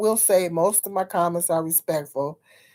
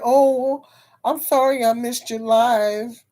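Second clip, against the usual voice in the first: mock apology; 6 semitones higher, 4 dB louder, slower than usual. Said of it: "I don't wanna hear".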